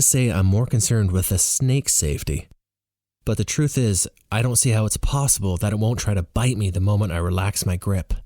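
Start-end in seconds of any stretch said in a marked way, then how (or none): none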